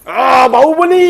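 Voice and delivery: playful heroic cry. Pretend. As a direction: shout it like a play hero's cry. Playfully hoisting the baby, calling Baahubali. (ತಮಾಷೆಯ ಜೋರಾದ ವೀರ ಕೂಗು)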